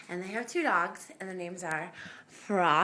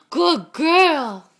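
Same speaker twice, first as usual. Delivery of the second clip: excited praise; 3 semitones higher, 12 dB louder than usual. Real, not acted. Owner praising her dog, loud and delighted. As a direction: gasp then cheer her on.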